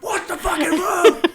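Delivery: laughing high yell from the back of the bike; panicked yell. Retold or imitated. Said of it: "I'm like".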